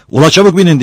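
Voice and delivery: high, loud command; urgent shout. A narrator's reading. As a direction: shout for everyone to hurry aboard. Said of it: "diye bağırdı". (telaşla bağırarak)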